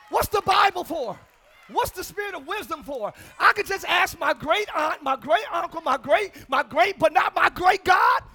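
loud, rousing sermon voice; fiery preaching. Real, not acted. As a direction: preach it out to the congregation. Preaching at full force.

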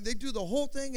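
ordinary talk

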